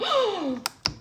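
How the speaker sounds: sudden high startled gasp; startled gasp at the chat; sharp gasp. Real, not acted. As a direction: catch your breath in shock. Startled by something on screen.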